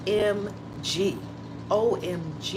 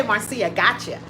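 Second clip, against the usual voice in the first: normal talking voice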